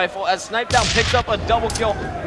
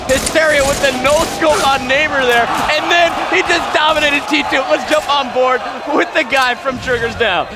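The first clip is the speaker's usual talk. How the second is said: raised, loud esports commentary; live play-by-play; 4 semitones higher, 5 dB louder than usual. Real, not acted. Caster calling a no-scope on Neighbor, excited play-by-play. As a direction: call the kills at full pitch.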